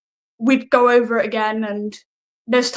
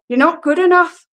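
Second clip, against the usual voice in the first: put-on lilting Newcastle-like accent, pitched up and louder; 6 semitones higher, 5 dB louder than usual. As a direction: slip into an accidental Newcastle twang. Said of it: "I do it in this accent".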